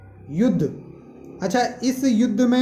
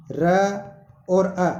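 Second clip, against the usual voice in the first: slow sound-by-sound breakdown; much slower than usual. Shown as a demonstration. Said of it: "बोल के देखो".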